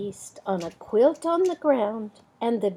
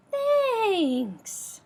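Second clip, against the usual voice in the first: lifted bear voice, falling thanks; a grateful storybook bear; high bear voice; 11 semitones higher than usual. Acted. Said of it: "And the bear says".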